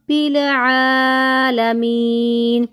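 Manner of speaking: slow, melodic tajweed chant; teacher reciting verse slowly, melodic chant; Quran recitation. Shown as a demonstration. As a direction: draw out each vowel in recitation.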